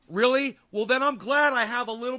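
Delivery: high loud sarcastic really; mock surprise. Real, not acted. Streamer answering a chatter's sugar warning, sarcastic disbelief. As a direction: scoff in disbelief. Mocking a chat claim.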